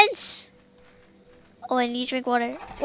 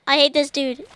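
ordinary talk, nothing marked

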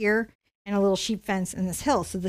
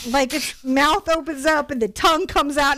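ordinary talk, nothing marked

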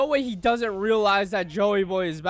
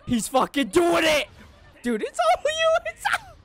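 ecstatic cheering; 7 semitones higher than usual. Real, not acted. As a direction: shout climbing into a squeal. Hyped as character succeeds.

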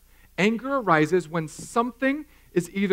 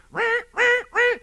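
much higher, comic beast roar; amygdala roar; 3 dB louder than usual. Pretend. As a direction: roar like an enraged brain. Amygdala roaring in anger.

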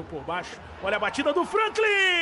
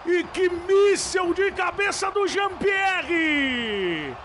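explosive goal commentary; 6 dB louder than usual. Real, not acted. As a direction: erupt as the ball hits the net. Goal called in live commentary.

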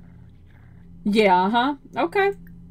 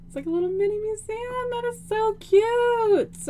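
cooing; 10 semitones higher than usual. Real, not acted. Admiring a tiny museum.

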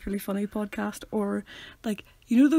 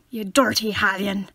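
slower, louder put-on Irish accent, "dirty" as "dorty"; 6 dB louder, slower than usual. Shown as a demonstration. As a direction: lean into a broad Irish accent. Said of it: "if you want to go Irish".

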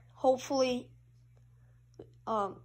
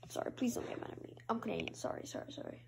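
hushed, hurried pleading; meek mock apology; 12 dB quieter, faster than usual. Gamer apologises to opponent as a Canadian, meek hushed pleading. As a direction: shrink into a whispered playful apology. Apologises after a hit in game.

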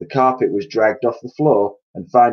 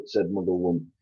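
ordinary delivery

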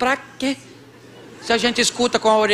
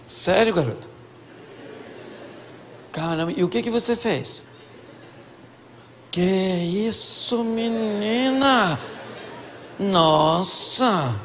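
hushed, drawn-out mock amazement; soft, hammed-up attentive listening; exaggerated interest; 5 dB quieter, slower than usual. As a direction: stretch each reaction as if utterly engrossed. Husband acting engrossed while wife talks.

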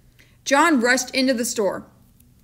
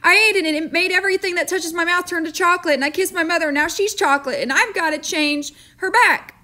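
put-on fast, higher, breathless boy voice; babbling boy; 7 semitones higher than usual. A narrator's reading. Boy babbling his story to the storekeeper, fast and higher. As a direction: tumble the words out like a panicked boy. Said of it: "he babbled on".

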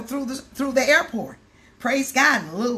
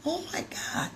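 awed whisper; 6 semitones higher, 9 dB quieter than usual. Retold or imitated. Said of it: "He said".

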